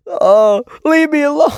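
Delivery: high, laughing whine; mock plea. Real, not acted. About the game eating his life, a high laughing whine. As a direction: whine it in joking despair.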